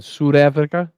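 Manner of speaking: questioning attempt at a South African accent. Host asking a viewer how they'd say it, attempted South African accent. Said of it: "how would you say that in your accent".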